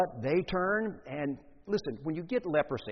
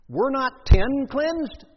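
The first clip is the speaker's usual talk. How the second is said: much higher and louder, slow and weighty; booming rhetorical question; 10 semitones higher, 11 dB louder, much slower than usual. Acted. Jesus asking the crowd where the healed went, booming and slow. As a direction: hammer the question out slowly to the crowd.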